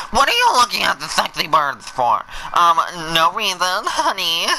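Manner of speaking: nagging spouse. Acted. Lightly voiced wife scolding the player about the birds. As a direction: play a suspicious wife. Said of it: "mock spouse quarrel over birds".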